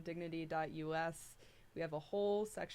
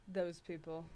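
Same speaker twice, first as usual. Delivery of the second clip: mock contempt for an out-group; that disdainful tone; slower than usual. Shown as a demonstration. Host quoting a bumper sticker idea, mock contempt. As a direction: say it with pointed, put-on disdain. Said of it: "you have to say it in that tone".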